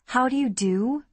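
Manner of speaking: high, rising incredulous echo. Acted. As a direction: repeat it as a disbelieving question. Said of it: "I repeated incredulously".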